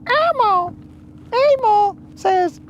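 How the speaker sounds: high squeaky puppet voice; Elmo voice. Pretend. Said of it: "Elmo, what do you think?".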